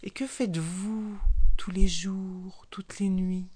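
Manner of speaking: unvoiced sneaky whisper; sly whisper. Sly snake whispers his question to the frog. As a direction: breathe the question, scheming.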